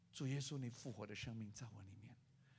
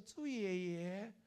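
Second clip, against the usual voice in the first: put-on slow sweet call of a child; a lightly voiced child greeting; a kid greeting grandpa Zhu; child's greeting; 5 semitones higher, 4 dB louder, much slower than usual. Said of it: "连小孩说".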